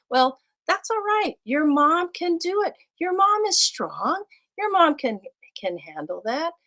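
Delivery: put-on high, bright encouragement to a child; high reassuring voice to a small boy; reassuring teacher. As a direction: lift him up gently. Teacher reassuring a young student.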